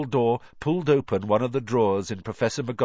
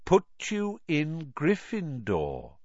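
calm; 8 semitones higher, slower than usual. A narrator's reading. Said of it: "said Dumbledore calmly".

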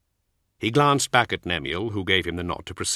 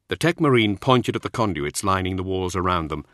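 plain reading voice

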